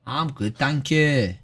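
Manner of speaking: ordinary delivery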